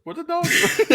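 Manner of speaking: high squeaky "What are dog doing?" with laugh; squeaky catchphrase. Shown as a demonstration. Echoing the catchphrase back, high and laughing. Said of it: "Say it".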